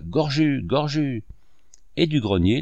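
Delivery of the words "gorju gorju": mild call across the yard, slightly higher. A narrator's reading. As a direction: call the farmhand by name. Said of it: "Elle cria".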